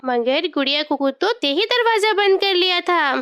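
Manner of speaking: plain reading voice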